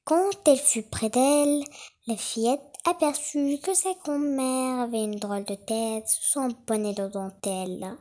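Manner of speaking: plain reading voice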